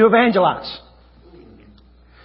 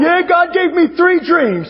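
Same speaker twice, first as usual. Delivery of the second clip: high, drawn-out wailed confession; mock bawling; 7 semitones higher, slower than usual. Preacher acting out his wife confessing the dreams, mock bawling. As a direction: wail it as if bawling. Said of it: "started just bawling".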